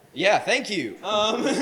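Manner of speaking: usual speaking voice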